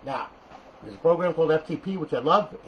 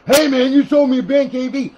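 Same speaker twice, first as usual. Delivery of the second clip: loud, high mock complaining buyer; whiny customer; 10 semitones higher, 11 dB louder than usual. A buyer complaining to the seller, loud mock whine.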